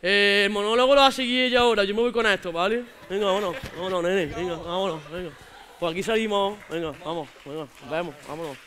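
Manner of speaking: put-on swaggering street lad; casual macho slang voice; Antonio. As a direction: play a cocky local guy chatting up strangers. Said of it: "cuando he venido como Antonio".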